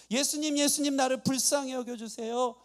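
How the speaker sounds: high, urgent repeated calling; acted loud cry. Blind man ignores the crowd, calls Jesus, acted shout. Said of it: "하면서 크게 고함을 친 것입니다".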